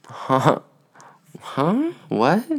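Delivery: high-pitched retold huh huh what, rising in shock; stunned disbelief. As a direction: react as if you can't believe it. Retold disbelief at death news.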